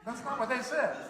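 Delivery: squeaky high "what?", then loud disbelief; shocked that the name is different; surprised. Real, not acted. Surprised at pronunciation.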